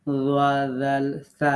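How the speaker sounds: "ওয়া, দাল, তা" with a soft tongue; touch the tongue gently for each of "ওয়া, দাল, তা"; soft articulation. Shown as a demonstration. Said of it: "জিহ্বাতে নরম করে সফটলি উচ্চারণ". ("ওয়া, দাল, তা" নরম করে উচ্চারণ)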